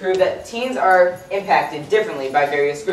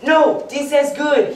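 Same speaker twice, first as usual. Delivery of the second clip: imitating a friend's heavy Spanish accent, higher and slow; playful Spanish accent impression, pitched up; 7 semitones higher, slower than usual. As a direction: drawl it out slowly in a Spanish accent. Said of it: "he said in his heavy Spanish accent".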